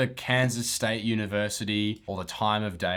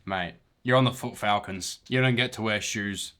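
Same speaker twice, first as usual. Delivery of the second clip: ordinary talk, nothing marked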